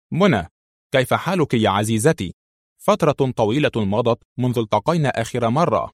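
ordinary delivery

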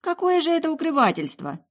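high, protesting exclamation; indignant young woman, voice shooting up; indignant girl. Acted. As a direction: protest the accusation hotly. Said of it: "возмутилась девушка".

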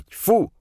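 sharp high spitting sound; disgusted spit. Acted. Old man spits in disgust.

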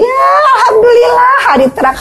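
mock the child with fake praise; mother mocking her child's rare chore, sarcastic shout.

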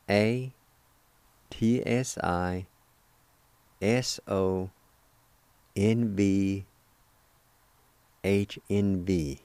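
careful, slow spelling for learners; slow letter-by-letter spelling. Shown as a demonstration. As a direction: spell each letter slowly, with long gaps. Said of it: "It's spelled like this".

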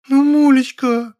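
very high, loud, drawn-out begging; long high kittenish whine; pleading mewl. A narrator's reading. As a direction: mew it out imploringly, slowly. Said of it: "Умоляюще промяукала она".

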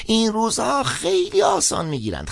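put-on high old man crying out indignantly; old priest shouting his grievance. A narrator's reading. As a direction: shout the complaint about schools. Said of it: "فریاد زد".

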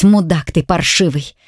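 ordinary delivery

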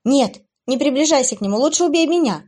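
high, loud pleading cry; desperate plea. Acted. Abby begging the demoness to spare Will, pleading. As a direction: beg her to take you instead. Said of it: "взмолилась Эбигейл".

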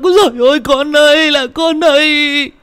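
high, loud wail, slowing at the end; screaming lament. Acted. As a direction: cry out for the dead child. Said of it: "Cô Đào hét lên một hồi".

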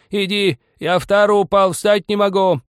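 very high, loud screeching complaint; angry shrill yell. A narrator's reading. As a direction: screech furiously for help. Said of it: "злобно-визгливо кричал старик".

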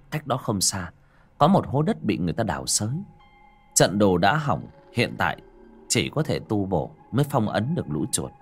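usual speaking voice

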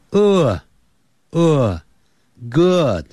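uh, uh, good, building the word from its vowel; sound breakdown. Shown as a demonstration. Teacher breaking good into sounds for kids. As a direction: start with uh then land on good. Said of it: "那个念起来有点像".

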